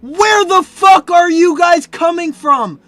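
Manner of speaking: high, loud exasperated shout; baffled, high-pitched yell; losing it. Real, not acted. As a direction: holler it at the chat in disbelief. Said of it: "I'm losing my shit now".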